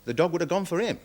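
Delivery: ordinary delivery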